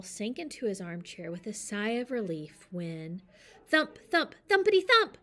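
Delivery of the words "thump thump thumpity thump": building to a very high, loud crash; loud thumping crash. A narrator's reading. Narrator builds the noise to a big crash.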